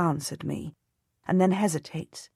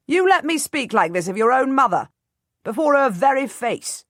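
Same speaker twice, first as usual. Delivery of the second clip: high, loud, cracking rage; furious; 8 semitones higher, 6 dB louder than usual. A narrator's reading. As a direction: voice breaking with fury. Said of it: "his voice cracking with rage".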